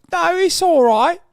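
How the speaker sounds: put-on high, drawn-out British accent; mock British authorities. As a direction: wave it away in a squeaky British caricature. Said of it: "British authorities saw that and were like".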